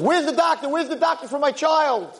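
high, frantic calling out for the doctor; desperate shout. Acted. Father bursting into the apartment for his child, frantic high shout. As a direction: burst in crying out where the doctor is.

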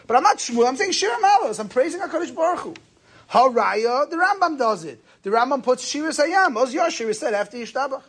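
lively Talmudic debater making his point; animated retold argument. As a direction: defend the practice with rising excitement. Rabbi voiced arguing his defense.

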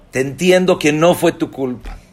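high, loud, insistent reassurance; emphatic validation. Acted. Rabbis telling the hurt woman she is in the right, emphatic and loud. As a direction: back the listener up with rising force.

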